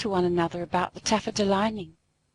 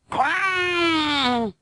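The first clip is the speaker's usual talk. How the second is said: cat yowl; 8 semitones higher, 4 dB louder than usual. Acted. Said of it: "made strange, horrid noises".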